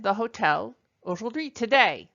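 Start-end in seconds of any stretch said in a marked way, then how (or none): none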